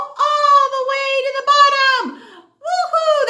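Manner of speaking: high, loud playful shout down the slide; mock cheer. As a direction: whoop as if riding down. Andy falls down elevator.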